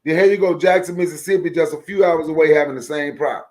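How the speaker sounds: hammering the point home, getting fired up; heated rant. Real, not acted.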